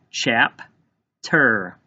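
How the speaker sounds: "Chapter" slowly as chap, pause, ter; split into syllables. Shown as a demonstration. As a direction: break "Chapter" into two separate syllables. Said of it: "tap out the first syllable".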